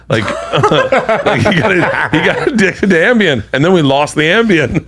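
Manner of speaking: talking through real laughter; amused, laugh breaking into the words. Real, not acted. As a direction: tell it while cracking up. Laughing while telling the Ambien story.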